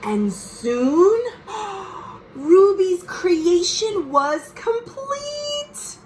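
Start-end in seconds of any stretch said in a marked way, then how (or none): 1.2-2.3 a sharp, high, breathy gasp of wonder; delighted gasp. Acted. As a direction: gasp like you see something amazing. Reader gasps at finished fort.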